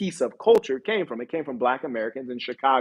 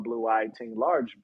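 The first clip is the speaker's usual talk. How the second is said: ordinary talk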